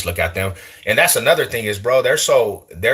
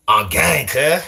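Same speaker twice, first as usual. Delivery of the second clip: exaggerated Cali gang accent, pitched up; mock Cali gang talk; 6 semitones higher, slower than usual. Shown as a demonstration. Streamer denying a Cali accent to viewers, mock Cali gang talk. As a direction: put on a hammed-up Cali homie accent. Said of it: "you don't hear me saying".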